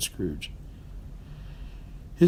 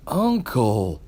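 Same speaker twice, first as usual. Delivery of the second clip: pleading. A narrator's reading. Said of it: "pleaded the nephew".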